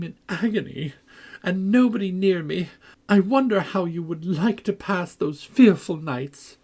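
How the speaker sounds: high, plaintive moaning complaint; self-pitying wail. A narrator's reading. Linton reproaching Cathy, high and plaintive. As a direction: wallow in self-pity. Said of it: "began to wail aloud".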